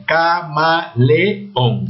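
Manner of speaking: CAMALEÓN split very slowly into ca-ma-le-ón; syllable by syllable. Shown as a demonstration. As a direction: sound out Camaleón in four separate syllables, e and ón apart. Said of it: "Tiene cuántas sílabas". (Camaleón muy lento, separado en cuatro sílabas: ca-ma-le-ón)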